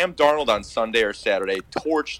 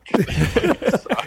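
laugh; 10 semitones higher than usual. Real, not acted. Laughs at own joke.

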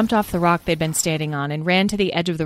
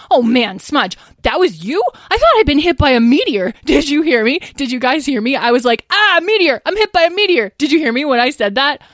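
excited chatter; 9 semitones higher, 5 dB louder than usual. A narrator's reading. Lyle excitedly recounting.

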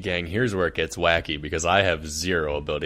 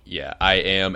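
normal talking voice

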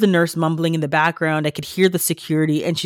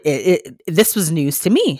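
usual speaking voice

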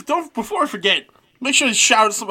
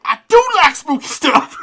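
put-on voice; 5 semitones higher, 5 dB louder than usual. Girl line, voice put on.

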